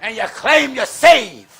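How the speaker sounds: fiery shouted preaching. Acted. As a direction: preach it hard to the pews. Preaching to the congregation.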